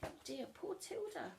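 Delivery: soft, high pitying aside; sympathetic. A narrator's reading. Narrator pitying Tilda, softly sympathetic. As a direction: coo it with pity.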